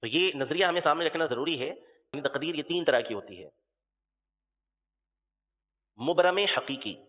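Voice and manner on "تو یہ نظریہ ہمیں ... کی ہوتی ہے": ordinary talk, nothing marked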